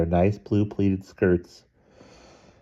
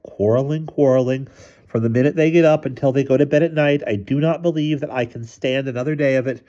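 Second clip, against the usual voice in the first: higher, fed-up complaint; exasperated, raised-pitch muttered rant; exasperated mother; 7 semitones higher, 3 dB louder than usual. A narrator's reading. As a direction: vent to yourself, fed up and worked up.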